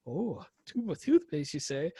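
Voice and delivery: a hint of a scheming shopkeeper; lightly voiced vendor. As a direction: play the seller sizing up a customer. Vendor sizing up American customer.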